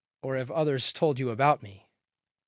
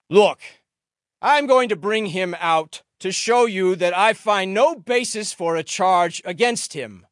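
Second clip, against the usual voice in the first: louder, higher, projected address; public announcement; 6 semitones higher, 9 dB louder than usual. A narrator's reading. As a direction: announce it to the whole crowd. Pilate addresses the gathered crowd.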